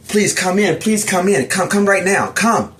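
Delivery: high, pressing "come in" calls; urgent pleading. Retold or imitated. Narrator urging fleeing people into the cabin, urgent and high. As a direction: hurry them inside, desperate.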